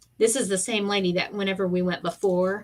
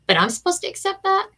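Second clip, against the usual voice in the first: incredulous retort; 12 semitones higher, 4 dB louder than usual. Retold or imitated. Retold complaint, pitch jump.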